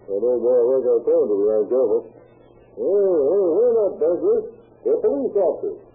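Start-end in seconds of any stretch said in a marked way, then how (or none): 2.8-5.8 raised voice through the door; calling out. Acted. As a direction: call out so they hear inside.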